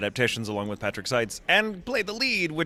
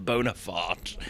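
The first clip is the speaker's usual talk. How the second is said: foppish British; 2 semitones lower, slower than usual. Retold or imitated. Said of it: "in that voice".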